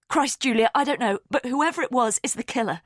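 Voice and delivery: exasperated. A narrator's reading. Exasperated outburst.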